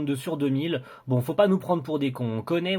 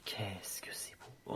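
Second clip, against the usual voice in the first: slow, hushed whisper of admiration; awed whisper; 6 semitones lower, 19 dB quieter, much slower than usual. Real, not acted. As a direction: whisper it slowly, moved by beauty. Awed by picture of Kaori.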